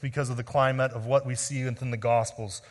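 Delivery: usual speaking voice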